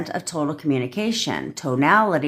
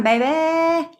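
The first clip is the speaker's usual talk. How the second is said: exultant cheer; 12 semitones higher than usual. Real, not acted. Speaker celebrating that third time's a charm, exultant. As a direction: sing it out high in triumph.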